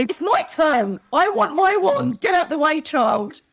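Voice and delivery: high, loud, slow mock tantrum; hammed-up pushy fan at the queue; mock bratty demand. Shoving children aside to get her wand, mock demanding. Throwing kids out the way.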